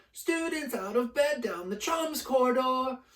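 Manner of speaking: high, loud gleeful shout; bellowing. Acted. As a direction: call it down the corridor. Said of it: "Peeves bellowed".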